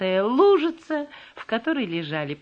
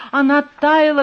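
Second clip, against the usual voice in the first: usual speaking voice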